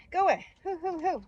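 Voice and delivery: high-pitched shooing; high, hooting shoo; shooing a bee. Real, not acted.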